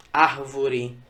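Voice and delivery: "árvore" com o AR inicial forte; tônica em AR. Shown as a demonstration. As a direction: acentue a primeira sílaba de "árvore". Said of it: "e sim o ar". ("árvore" começando com peso no "ár")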